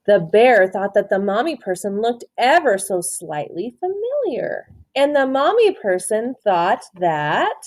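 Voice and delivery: plain reading voice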